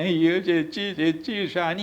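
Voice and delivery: high-pitched mocking imitation of whining; mock whining plea. Party man moaning to a TV anchor, mock whining. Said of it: "പരാതി പറഞ്ഞിങ്ങനെ ... ഇങ്ങനെ കരയാതെ".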